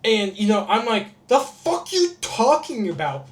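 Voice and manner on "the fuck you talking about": higher, drawn-out indignant demand; exasperated disbelief. Retold or imitated. Venting about people saying Bay Area, exasperated. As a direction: slowly stretch it out in baffled frustration. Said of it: "it was just a point of frustration".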